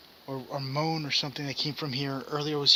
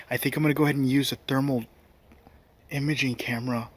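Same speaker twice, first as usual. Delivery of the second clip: usual speaking voice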